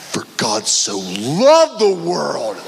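very slow, dragged-out reading of the verse. Retold or imitated. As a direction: linger on each word as if seeing it new.

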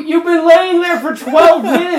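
very high, loud accusing holler; yelling across gym. Pretend. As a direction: shout down a faker from across the room. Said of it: "from the back of the gym yell out".

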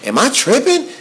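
baffled, asking the silent car; incredulous. Retold or imitated. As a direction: pitch jumps way up on "tripping". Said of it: "And I'm like".

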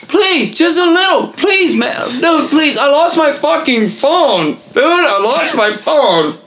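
high, slow, desperate pleading; whiny drunk begging. Retold or imitated. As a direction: whine it out like a drunk who lost everything. Drunk friend begging, lost phone.

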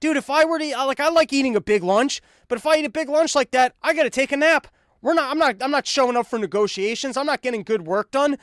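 high-pitched, baffled protest; incredulous questioning. Acted. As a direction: challenge him like a disbelieving buddy. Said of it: "and you're almost like".

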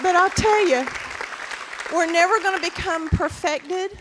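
full-voiced sermon punch; preaching at full force. Real, not acted. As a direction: declare it to the whole room. Preaching climax.

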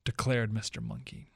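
ordinary talk, nothing marked

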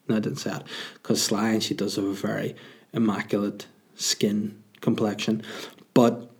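usual speaking voice

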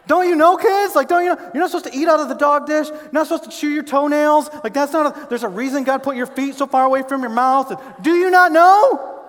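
high, loud fed-up parent scolding; exasperated parent. Retold or imitated. Parent listing rules to kids, loud and exasperated. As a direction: pile on the disbelief at the kids. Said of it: "Parents, you ever had things come out".

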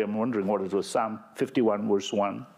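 ordinary talk, nothing marked

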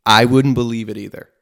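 loud, emphatic aside. Real, not acted. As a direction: push it out loud. Addressing doubting audience.

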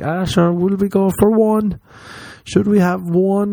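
high lilting Irish; Irish pub voice. Retold or imitated. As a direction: coax like an Irish drinker. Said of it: "and you're like".

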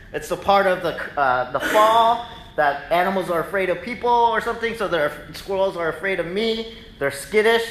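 normal talking voice

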